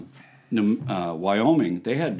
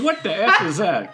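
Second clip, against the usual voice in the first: mock disbelief; 9 semitones higher, 4 dB louder than usual. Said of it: "I said".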